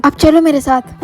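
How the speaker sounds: normal talking voice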